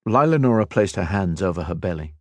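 ordinary delivery, nothing marked

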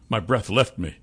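ordinary delivery

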